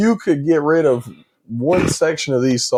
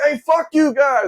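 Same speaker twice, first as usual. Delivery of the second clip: mock angry yell; 13 semitones higher than usual. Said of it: "He's like".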